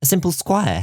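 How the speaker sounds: Bloom teasing "A simple squire", high rising pitch on "squire"; playful tease, "simple squire" lifted high. Acted. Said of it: "not as humble as you sound".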